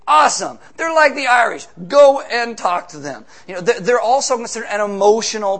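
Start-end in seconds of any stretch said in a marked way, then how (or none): 0.1-3.2 loud, eager call to go; excited urging. Real, not acted. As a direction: fire them up to go out. Preacher urging outreach.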